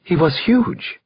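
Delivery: frightened witness, mildly voiced; whimpering. A narrator's reading. Shaken witness describing the gunman to detective, mild whimper. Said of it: "she whimpered to me".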